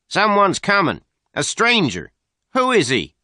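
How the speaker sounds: high, loud alarmed calling. A narrator's reading. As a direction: sound the alarm to the others. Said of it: "the man cried".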